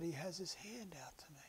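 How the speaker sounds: hushed, breathless whisper of a dying woman; awed whisper. Retold or imitated. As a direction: whisper it as if he stands right there. Dying woman speaks softly.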